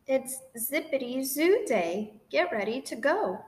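ordinary delivery

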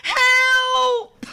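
high, loud frightened cry; crying for help. A narrator's reading. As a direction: cry out in panic.

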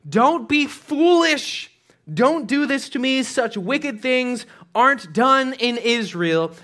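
high, slow begging; desperate pleading. Retold or imitated. Sister begging her brother not to harm her. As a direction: plead slowly, drawing each word out.

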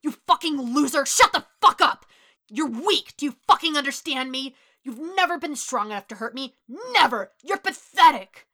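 loud, high berating shout; raging tirade; furious anger. A narrator's reading. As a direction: hurl insults at full volume. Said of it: "Kacchan's anger".

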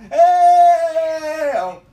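slow, high, loud wailing cry for help; mock crying wail. Begging Harrison for help, drawn-out mock wail. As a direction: stretch it out like a sobbing plea.

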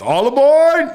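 conductor call. Retold or imitated. Guard waves them onto train.

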